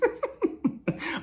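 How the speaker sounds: squealing laugh; high delighted squeal; high squeal of laughter. Real, not acted. Laughing at the showdown.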